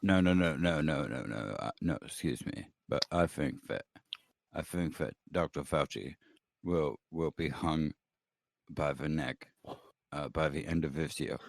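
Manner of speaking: very low, soft, halting pundit; William F. Buckley. Acted. Comedian predicts Fauci's fate to the panel, Buckley impression. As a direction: drop deep and stumble through it deliberately. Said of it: "why are you doing William F. Buckley's voice".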